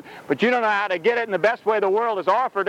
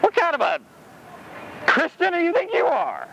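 mock-indignant, rising high; high, incredulous rebuke; indignant challenge; 7 semitones higher than usual. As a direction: act the scandalised churchgoer. Mocking churchgoers calling security.

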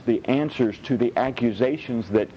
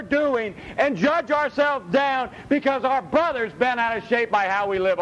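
forceful preaching; 11 semitones higher than usual. Acted. Preacher drives the verse home to the congregation, forceful. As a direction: lean on each phrase like a preacher.

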